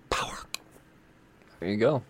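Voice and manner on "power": hushed, whispered punch word; dramatic whisper. Acted. Host ending a dramatic comic blurb, stagey whisper. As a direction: drop to a whisper for the climax.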